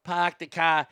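Boston accent with dropped r's. Shown as a demonstration. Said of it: "And the Boston one".

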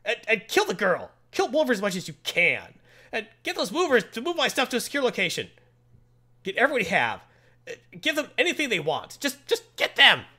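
high, pushy spoiled-boss commands; a spoiled kid barking orders; bratty villain orders. Retold or imitated. Bratty villain ordering his henchmen.

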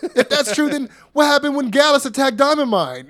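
high, taunting impression, rising on each jab; Waller trolling. Waller needles Apollo about his visions, trolling. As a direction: needle him in a high mocking voice. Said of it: "then Waller starts trolling".

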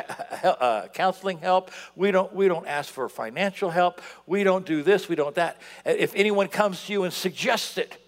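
ordinary delivery

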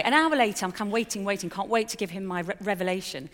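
ordinary talk

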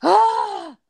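high, loud retold wail of despair; mock wail, like sobbing in the car; re-enacted cry of despair; anguished cry. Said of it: "I bawled my eyes out".

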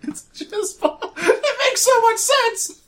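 high-pitched laughing, slow; laughing through words. Real, not acted. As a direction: giggle the words out, slowly. Laughing at friend's joke.

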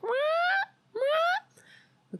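imitating the dog's whining cry. Owner mimics his crying dog for the stream, high wail. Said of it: "He's crying. He's like".

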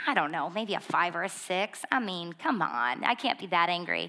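low, quiet, hedging reply; sheepish downplaying. Answering the counselor about her anger, sheepish and downplaying. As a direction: softly brush the question off.

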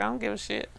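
quick, soft quoted brush-off; offhand dismissal. Retold or imitated. Woman quoting her reply to car braggers, soft offhand dismissal. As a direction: toss it away under the breath.

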